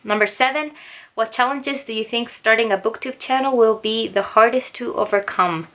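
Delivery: ordinary talk, nothing marked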